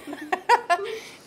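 high laugh. Real, not acted. Laughs at cameraman's complaint.

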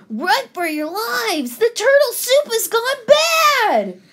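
high, loud cry of warning; alarmed shout. A narrator's reading. Fox warning everyone in the kitchen, high panicked shout. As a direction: raise the alarm, pitch climbing.

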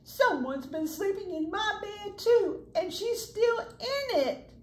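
high excited cub voice, slowing at the end; a little bear pointing at the girl in his bed; Baby Bear. Acted. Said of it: "hollered Baby Bear".

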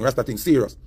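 usual speaking voice

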